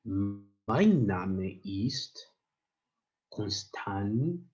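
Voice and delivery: Mein Name ist drawn out very slowly, syllable by syllable; slow model reading. Shown as a demonstration. Teacher modelling Mein Name ist for learners, very slow. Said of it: "just try to read after me".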